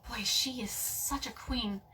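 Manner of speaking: amused whispered aside. Real, not acted. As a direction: breathe it out as a whisper. Aside about cat on seat.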